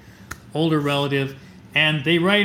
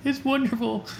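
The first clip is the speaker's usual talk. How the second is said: high, teary voice through sobs; overwhelmed, weeping with joy; choked-up sobbing reply; 8 semitones higher, 5 dB quieter than usual. Retold or imitated. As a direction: answer while crying happy tears. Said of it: "I'm literally sobbing".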